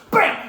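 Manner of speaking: high, loud "bam"; explosive impact shout; imitated punch. Narrator voices the villain's strike, loud bam. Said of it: "immediately goes".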